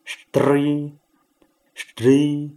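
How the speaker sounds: first String as rolled stri, second string as light stṛ; two syllables contrasted. Shown as a demonstration. Teacher reads both together: String rolled, then string light. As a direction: roll the r in String, then only tap it in string. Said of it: "两个字一起念".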